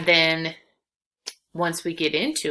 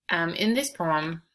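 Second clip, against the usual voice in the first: ordinary talk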